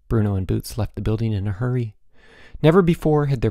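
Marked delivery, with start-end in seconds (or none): none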